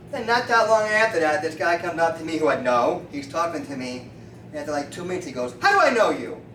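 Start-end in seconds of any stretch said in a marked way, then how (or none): 5.6-6.4 loud, raised-pitch question; acted blunt demand. Street acquaintance suddenly questions the chiropractor, blunt demand. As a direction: blurt it out, puzzled and abrupt.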